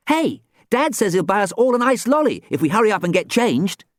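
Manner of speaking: high, loud eager call; excited cry. Acted. Twin running over with ice lolly news, high excited cry. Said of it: "cried Giles".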